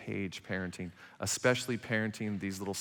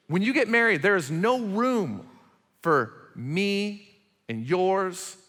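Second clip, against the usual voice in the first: louder, higher, emphatic sermon voice; forceful preaching; 6 semitones higher, 6 dB louder than usual. Acted. Pastor telling married couples it is our child, forceful preaching. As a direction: hammer home the point.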